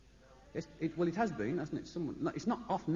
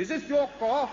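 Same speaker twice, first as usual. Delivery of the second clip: stern copper; 8 semitones higher, 7 dB louder than usual. Retold or imitated. Copper questions driver.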